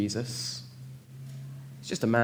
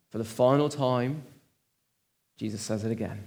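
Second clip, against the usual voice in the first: normal talking voice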